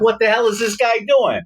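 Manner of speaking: very high-pitched retold astonishment; incredulous exclamation. Onlooker seeing his friend's karate stance, incredulous. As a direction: exclaim in squeaky-high disbelief.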